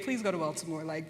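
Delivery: usual speaking voice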